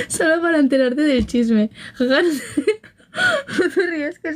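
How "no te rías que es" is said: higher teary laughing plea; laughing almost to tears; laugh-crying. Real, not acted. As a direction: plead through laughter. Laughing at own confession.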